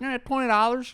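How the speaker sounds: put-on high, drawled country accent from the parking guy; mock country accent. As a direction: do the toothless Southern parking attendant's accent. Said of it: "that is literally how they talk".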